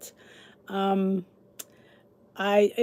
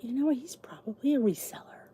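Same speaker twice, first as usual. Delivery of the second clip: quiet whisper of a private guess; whispered thought; 3 semitones higher, 7 dB quieter than usual. Retold or imitated. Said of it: "I thought".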